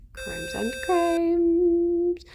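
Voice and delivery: high, very drawn-out singsong; playful singsong. Pretend. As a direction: stretch the words out high and slow. Playfully voicing the pet's crimes.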